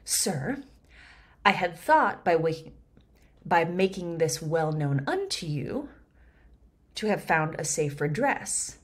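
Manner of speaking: measured verse reading; Shakespeare reading. Shown as a demonstration. Reads the verse.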